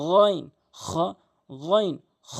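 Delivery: গইন and খ rasped from the back of the throat; teacher modelling গইন and খ from the end of the throat; Arabic throat letters. Shown as a demonstration. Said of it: "এভাবে উচ্চারণ করবেন".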